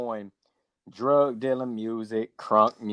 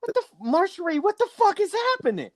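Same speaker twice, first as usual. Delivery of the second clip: exasperated disbelief. Real, not acted. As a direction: blurt it out in shocked disbelief. Exasperated at Marjorie's website.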